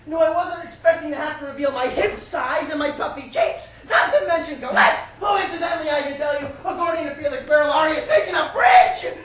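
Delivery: haughty complaint climbing to a loud peak; indignant mother. Acted. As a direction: build a mother's grand list of grievances. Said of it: "my mother says".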